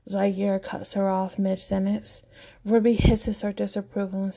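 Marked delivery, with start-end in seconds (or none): none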